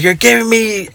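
high, mock-exasperated scolding; mom yelling. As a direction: imitate mom snapping at her son. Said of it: "she's yelling at me".

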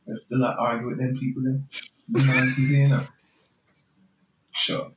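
lightly voiced patois angel; Jamaican patois.

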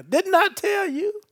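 Jesus as a Southwest Louisiana man; Southwest Louisiana accent. Retold or imitated. Preacher jokingly voicing Jesus, Southwest Louisiana accent. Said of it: "he from Southwest Louisiana".

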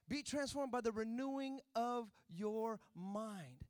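ordinary talk, nothing marked

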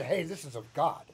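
ordinary talk, nothing marked

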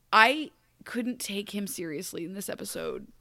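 ordinary delivery

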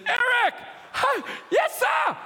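high, slow shouted surprise; a hammed-up startled yes sir; startled reply. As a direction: jump at being called. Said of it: "And then he says".

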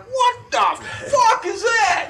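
high, loud, drawn-out outburst; startled mock yell. Man woken by a noise in the night, startled shout. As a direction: re-enact being jolted awake, yelling.

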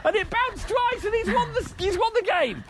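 high, rising commentator excitement; excited sports commentary. Pretend. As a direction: call the winning point like a thrilled commentator. Excited climax of own commentary.

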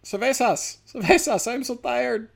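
high-pitched caricature calling for help; mock Mexican migrants. Comedian voices migrants outside Biden's house, mock pleading cries. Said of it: "a ton of Mexicans like".

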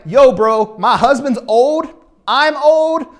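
incredulous protest. Retold or imitated. Preacher as old Sarah answering the angel, mock incredulous. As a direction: push back loudly in playful disbelief.

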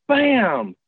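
high, drawn-out "bam"; sudden storyteller's crash effect; imitated slam. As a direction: voice the bang of a door being hit. Imitates something slamming the door.

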